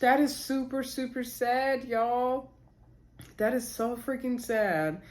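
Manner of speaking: high, slow and saddened; moved by sad news, voice lifted and slowed; choked up. Real, not acted. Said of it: "I'm getting choked up".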